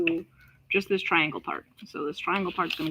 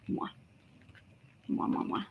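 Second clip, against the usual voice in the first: quick kiss; 3 semitones lower, 9 dB quieter than usual. Pretend. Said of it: "that was their kissing noise".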